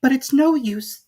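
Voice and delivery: put-on a girl sighing at herself; Alice's voice. A narrator's reading. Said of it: "thought poor Alice".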